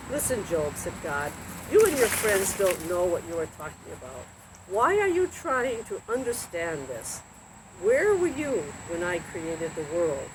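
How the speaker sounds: lively storybook reading with voiced characters; reading to children. A narrator's reading. Narrator reading a Bible story to children, lively. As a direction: read the Job story to kids like a bedtime tale.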